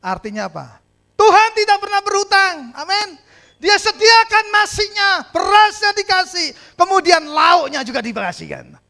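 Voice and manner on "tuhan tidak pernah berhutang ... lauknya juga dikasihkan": high, loud, climactic proclamation; fervent sermon climax; preaching at full force. Acted. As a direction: shout the victory to the crowd.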